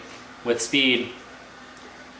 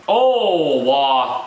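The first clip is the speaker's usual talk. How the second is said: sudden high, loud cry at the screen; shocked outburst; 5 semitones higher, 7 dB louder than usual. Real, not acted. Fan watching his team blunder, shocked loud cry. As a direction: blurt it out in disbelief. Reacting to bad play live.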